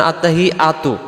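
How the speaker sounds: আত্তাহিয়াতু said quickly with short vowels; everyday careless আত্তাহিয়াতু, no held sounds; common mispronunciation. Shown as a demonstration. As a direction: demonstrate the mistaken, unstretched আত্তাহিয়াতু. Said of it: "এই শব্দটাতে আমরা কি ভুল করে ফেলি".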